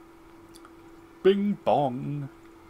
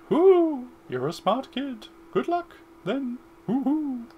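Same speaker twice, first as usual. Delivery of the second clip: high hooting wise owl voice, hoo-ing and chuckling; 12 semitones higher, 6 dB louder, slower than usual. Acted. Owl guide advising the boy in a high hooting voice. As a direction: play the old owl with a squeaky high voice. Owl's farewell lines.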